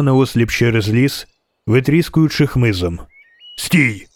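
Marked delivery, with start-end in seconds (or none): none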